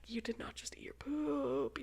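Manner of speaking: soft, breathless disbelief; whispered disbelief at the toddler; horrified whisper. Retold or imitated. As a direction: whisper it in disgust.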